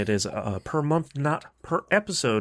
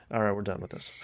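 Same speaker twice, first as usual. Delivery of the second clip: deadpan dismissal; 5 semitones lower, 6 dB quieter, much faster than usual. Retold or imitated. Parent dropping the cheer, wrapping up.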